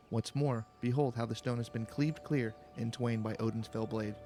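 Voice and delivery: normal talking voice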